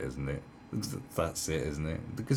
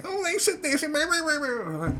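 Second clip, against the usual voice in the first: very high falsetto whine; squeaky imitation of whining, trailing into babble; mock whining. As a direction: whine it like a mocked complainer. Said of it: "start come whining to me".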